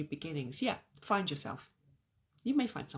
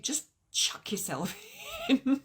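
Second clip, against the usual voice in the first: breaking into a real laugh mid-phrase; 8 semitones higher, 4 dB louder than usual. Real, not acted. Vlogger cracking up while urging viewers on, laughing. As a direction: let the giggle burst through the words. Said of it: "It's making me laugh".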